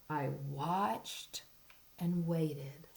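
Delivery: hushed suspense whisper. Acted. Suspense in story read-aloud.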